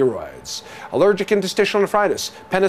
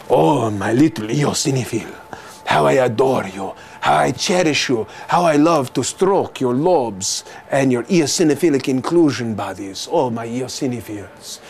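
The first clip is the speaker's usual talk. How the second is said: a lightly hammed-up lover, lower, cooing to his cells; mock lovestruck swoon; 7 semitones lower than usual. Lecturer serenading eosinophils to amuse students, mock lover's croon.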